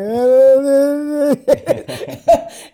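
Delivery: loud, high burst of laughter; cracking up loudly; big laugh. Real, not acted. Retelling getting eliminated, he bursts out laughing.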